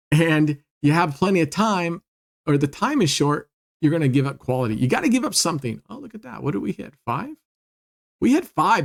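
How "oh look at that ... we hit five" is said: excited. Real, not acted. Number hits on the wheel.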